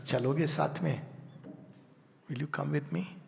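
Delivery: gently ask, barely above a breath; quiet invitation. Retold or imitated. The soul quietly inviting the listener to come along, hushed. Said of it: "It quietly comes and whispers".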